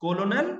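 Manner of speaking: कॉलोनल said letter by letter as ko-lo-nal; spelled-out misreading. Shown as a demonstration. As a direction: read कॉलोनल literally like the spelling. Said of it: "इसको क्या बोलेंगे". (कॉलोनल को spelling के हिसाब से गलत पढ़ना)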